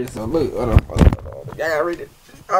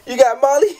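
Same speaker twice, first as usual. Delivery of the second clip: laughing shout; 8 semitones higher than usual. Real, not acted. Reading the text aloud, laughing shout. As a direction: blurt it out mid-laugh.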